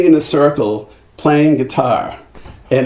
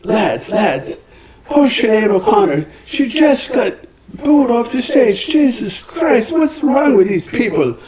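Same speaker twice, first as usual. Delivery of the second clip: high, excited Irish outcry, held high throughout; 10 semitones higher than usual. Retold or imitated. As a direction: rush in upset and call everyone to go. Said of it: "[name] comes running ... goes".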